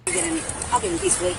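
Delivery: ordinary talk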